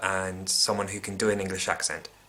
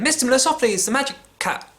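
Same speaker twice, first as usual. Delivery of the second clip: high, loud showman proclaiming himself; hammed-up magician announcement; 8 dB louder than usual. Mocking Mistopheles's self-announcement, high, loud showman. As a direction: announce yourself like a grand stage act. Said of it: "him failing magic tricks and going".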